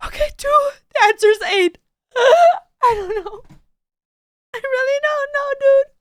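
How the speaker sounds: high, squeaky overwhelmed voice; flustered squeal. Pretend. Reacting overwhelmed to a video, high flustered squeal.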